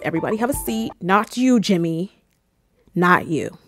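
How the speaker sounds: ordinary talk, nothing marked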